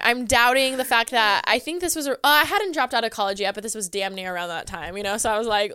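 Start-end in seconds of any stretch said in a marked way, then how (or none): none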